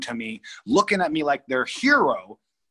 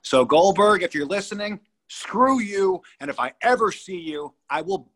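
raised, heated call-out, higher pitched; angry callout; 7 semitones higher, 3 dB louder than usual. Real, not acted. Wrestler calling out his ex-hero, angry. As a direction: tell him off with real anger.

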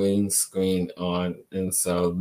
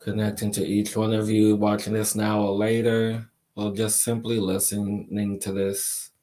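guided meditation. Acted. Leads a crystal energy meditation.

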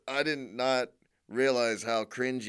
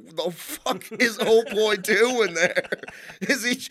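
high, loud, laughing disbelief; like watching a trainwreck; incredulous mock; 9 semitones higher, 8 dB louder than usual. Reacting to The Room roof scene, high laughing disbelief. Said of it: "'cause I'm just like".

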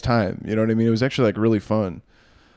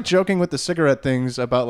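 normal talking voice

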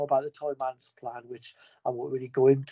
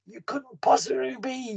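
higher, slow, amazed Superman voice; stunned disbelief; 10 semitones higher than usual. Retold or imitated. Superman meeting Santa, higher and slow in amazement. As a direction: let it drag out in wonder.

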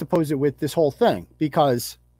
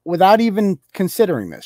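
ordinary delivery

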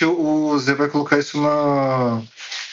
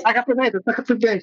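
egging on; 9 semitones higher than usual. Acted. Egging on, high.

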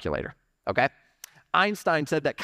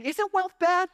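mock objector in a much higher voice; objecting congregant; 14 semitones higher, slower than usual. Church member questioning the preacher on money, in a high put-on voice. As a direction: pitch it way up, imitating a doubter. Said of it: "you got this voice".

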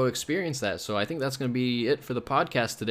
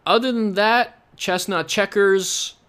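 loud, higher, slow joke sign-off; goofy hype; 7 semitones higher, 6 dB louder, slower than usual. Real, not acted. Podcaster signing off with a goofy loud catchphrase. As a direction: drawl the nonsense phrase with mock grandeur.

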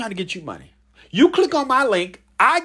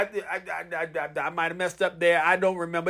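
usual speaking voice

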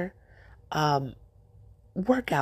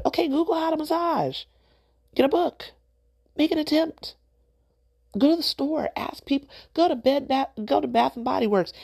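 much higher, insistent pushing; exasperated urging; 10 semitones higher than usual. Real, not acted. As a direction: push them to just try it. Exasperated coaching, pitch much higher.

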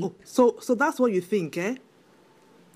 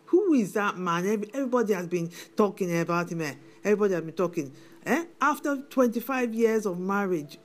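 usual speaking voice

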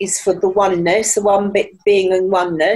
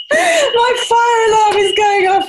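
shouting over fire alarm; 12 semitones higher than usual. Real, not acted. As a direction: shout it above the beeping. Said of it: "can you hear my fire alarm going off".

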